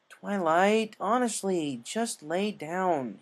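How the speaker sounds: sleepy dragon tells Twilight to lie down; grumpy Spike. A narrator's reading. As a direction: drag it out like a tired kid annoyed. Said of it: "he grumpily said".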